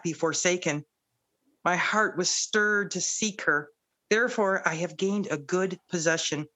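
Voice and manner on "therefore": ordinary delivery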